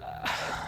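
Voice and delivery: a long, soft, resigned sigh; heavy reluctant sigh. Retold or imitated. Teacher sighing before giving his opinion, resigned. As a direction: breathe out slowly before giving in. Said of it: "He's very reluctant to say it".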